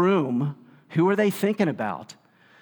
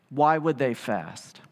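ordinary delivery

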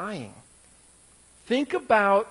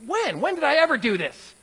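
higher, indignant pushback; defensive retort; 6 semitones higher than usual. Retold or imitated. Husband pushes back defensively at a vague accusation. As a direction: bristle and demand proof. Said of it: "my first response is like".

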